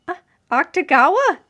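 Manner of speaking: confused stammer. A narrator's reading. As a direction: stumble over the name in disbelief. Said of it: "Atsushi stammered".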